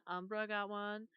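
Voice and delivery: normal talking voice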